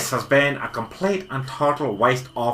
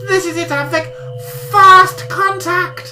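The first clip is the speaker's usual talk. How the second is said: rising higher and louder, then slow; awestruck building excitement; 13 semitones higher, 6 dB louder than usual. Acted. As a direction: build to a slow, awed climax. Building to first contact.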